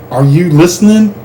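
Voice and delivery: usual speaking voice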